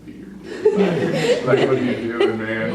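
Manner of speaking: a slow, amused "what are you doing"; Jesus teasing fondly. Retold or imitated. Jesus laughing at soaked Peter, teasing. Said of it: "you can imagine Jesus says".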